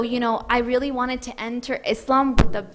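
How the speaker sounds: normal talking voice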